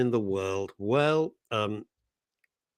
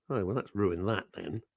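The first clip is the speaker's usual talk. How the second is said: ordinary talk, nothing marked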